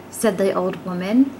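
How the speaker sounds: ordinary delivery, nothing marked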